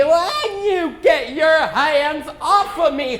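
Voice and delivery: hammed-up grumpy worm; upset worm. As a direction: play an offended worm. Worm yells when lifted.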